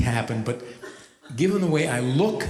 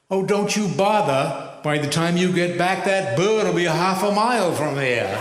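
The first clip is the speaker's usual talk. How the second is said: higher, slow Maine drawl for the captain; 5 semitones higher, 3 dB louder than usual. Retold or imitated. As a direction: play the unbothered ferry captain, Down East. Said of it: "the captain replied".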